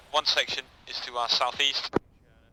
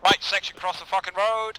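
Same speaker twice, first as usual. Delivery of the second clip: shouted order to cross; combat callout; 5 semitones higher than usual. Real, not acted. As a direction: bark the order over gunfire. Leader ordering crossing under fire.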